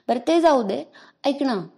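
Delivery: ordinary delivery, nothing marked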